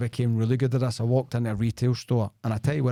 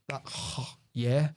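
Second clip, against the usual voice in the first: mock sighing yeah; 4 dB quieter than usual. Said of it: "And I'm like".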